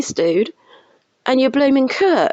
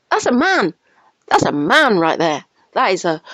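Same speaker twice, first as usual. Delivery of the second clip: ordinary talk, nothing marked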